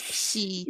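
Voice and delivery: "Xi" opening on an sh sound. Shown as a demonstration. Teacher explains the X to learner, "Xi" with an sh sound. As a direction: say "Xi" like English "she". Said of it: "an S H sound".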